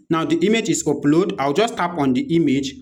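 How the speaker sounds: ordinary delivery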